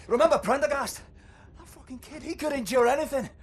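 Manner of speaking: high, loud bitter challenge; bitter outcry. Acted. Old fighter raging at Blazkowicz over lost men, bitter. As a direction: fling the name at him.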